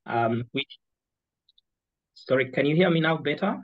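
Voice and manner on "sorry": ordinary talk, nothing marked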